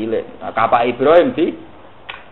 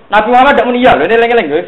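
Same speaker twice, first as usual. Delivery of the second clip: emphatic preaching; 9 semitones higher, 6 dB louder, much faster than usual. Real, not acted. Preacher stresses the key point.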